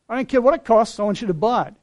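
loud, pushy rich man who won't take no; demanding shout. Retold or imitated.

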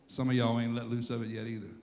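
ordinary talk, nothing marked

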